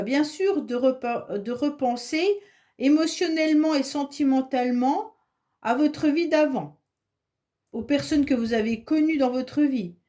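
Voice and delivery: ordinary delivery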